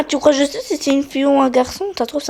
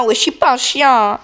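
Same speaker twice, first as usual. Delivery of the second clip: normal talking voice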